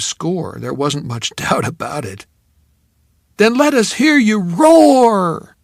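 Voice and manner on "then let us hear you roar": high, loud, slow cheer; cheerleader call. Acted. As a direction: draw it out to rouse the crowd.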